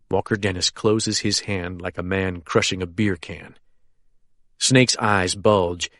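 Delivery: usual speaking voice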